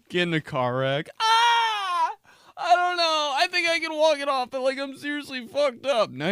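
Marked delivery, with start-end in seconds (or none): 1.2-6.1 high, whiny mock crying; hammed-up pain. Boy after car wreck overplays his pain to mom, mock crying. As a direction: fake a sob to milk the injury. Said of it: "I'd act like I was hurt".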